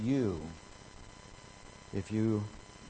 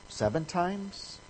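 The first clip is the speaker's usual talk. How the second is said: slow, soft, hopeful offer; tentative question; 6 semitones higher, 5 dB quieter, slower than usual. Retold or imitated. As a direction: float it as a hesitant guess. Peter's tentative offer to Jesus.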